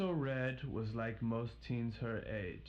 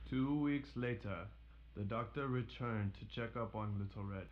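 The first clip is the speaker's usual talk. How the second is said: ordinary delivery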